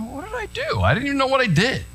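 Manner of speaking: high-pitched pretend protest; mock outrage at being pulled over; whiny indignant driver. Pastor mocking the driver he didn't sound like. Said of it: "But I didn't talk like that".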